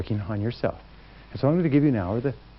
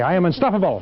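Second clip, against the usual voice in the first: higher and louder, with conviction; like you mean it; 6 semitones higher, 4 dB louder than usual. Shown as a demonstration. Speaker leading the crowd, calling it out with conviction. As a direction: declare it as if you mean every word. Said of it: "say it like you mean it".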